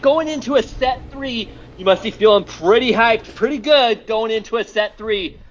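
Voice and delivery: upbeat host read; announcer voice. Pretend. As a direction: pitch it like a show host. Hyped host outro and interview.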